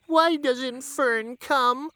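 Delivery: a lost little pig close to tears; forlorn Wilbur. A narrator's reading. Wilbur longing for Fern, very high, slow and forlorn.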